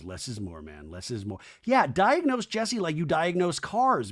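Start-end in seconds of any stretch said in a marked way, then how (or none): 0.0-1.4 low, soft aside; murmured counsel, imitating a friend; quiet advice. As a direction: say it low, under your voice. Acts out Luke quietly advising Jesse.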